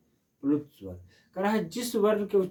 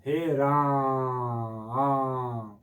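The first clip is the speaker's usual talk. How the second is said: drawn-out calling; much slower than usual. Shown as a demonstration. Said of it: "बुला रहे हैं ना".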